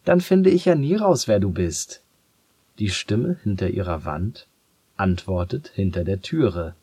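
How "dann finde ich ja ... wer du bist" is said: hoch und laut beginnend; lauter Ruf. Acted. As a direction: ruf frustriert durch die Tür. Ruft weiter durch die Tür.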